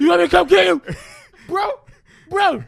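put-on very loud, high drunken holler; slurred drunk shout. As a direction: bellow it blurrily at a buddy. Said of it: "I'm slurring at this point".

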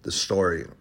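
ordinary delivery

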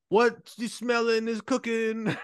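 slow, high, drawn-out catchphrase; hammed-up wrestler catchphrase, stretched out high; mock awakened hero. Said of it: "you woke up and you're like".

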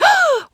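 very high, sharp intake of breath; delighted gasp. Retold or imitated. Fan reading her idol's reply tweet, high excited gasp. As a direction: gasp as if the tweet can't be real.

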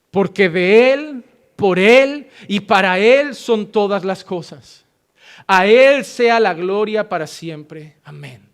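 slow, solemn, word by word; slow scripture reading. Shown as a demonstration. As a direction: read the verse slowly and weightily. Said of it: "Vamos al versículo treinta y seis". (lectura lenta y solemne del versículo)

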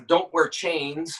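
normal talking voice

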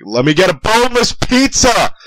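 yelled at the top of lungs, high; screaming. Retold or imitated. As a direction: scream it out in a crowd. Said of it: "really fucking loud".

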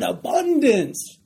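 big, drawn-out emphasis on "abundance"; stress on ABUNDANCE. Shown as a demonstration. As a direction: hit the word "abundance" hard and draw it out. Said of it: "sometimes we emphasize that word abundance".

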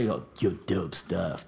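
put-on low, slow drunken slur; a hint of a drunk partygoer; drunk guy. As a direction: lightly slur it like a drunk guest. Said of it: "This guy's drunk out of his fucking ass".

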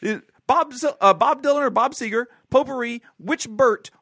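game show host. Pretend. Host giving Paul the quiz categories, announcer delivery. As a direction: present the categories with showy gusto.

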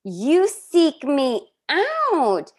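high, very slow dramatic Janice voice; Janice impression. Host performing Janice's foghorn speech, slow and high. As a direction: stretch every word like a soap diva. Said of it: "your Janice impression".

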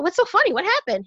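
high-pitched, eager questioning; acted high curious bystander; puzzled passerby. Joker voices puzzled passerby.

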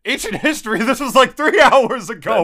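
high, loud disbelief; incredulous. Real, not acted. Host to co-host disputing "ancient history", high incredulous. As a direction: scoff at the idea it was long ago.

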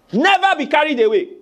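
rally them, punch every line; halftime pep speech. Acted. Preacher exhorting the congregation.

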